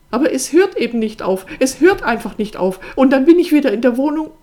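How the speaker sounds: very loud, high outburst, speeding up; desperate, choking up. A narrator's reading. Sister breaking down before her brother, desperate. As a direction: cry it out in mounting desperation. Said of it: "Ihre Stimme erstickte".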